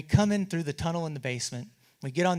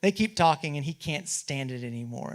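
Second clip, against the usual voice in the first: ordinary talk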